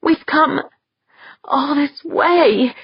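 high, slow, pained voice near tears; panting from the pain. Acted. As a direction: push the words out through the pain. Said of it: "panting from the pain".

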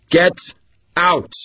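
out, yelled high; sharp yell. A narrator's reading. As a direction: bark them out the door. Boss chasing off the doctor.

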